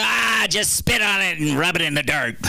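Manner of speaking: gruff dismissive mom voice, called out; tough mom. Retold or imitated. Mom dismissing her kid's gash with a gruff shout. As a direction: brush it off like a no-nonsense mom.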